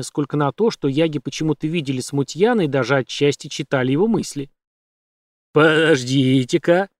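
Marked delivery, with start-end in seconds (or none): 5.5-6.9 slow creature call. A narrator's reading. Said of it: "крикнул один огненный столб".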